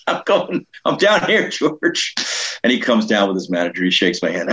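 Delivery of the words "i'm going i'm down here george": high-pitched answering call; mock calling back. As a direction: imitate calling up the stairs to someone. Calls back upstairs to George.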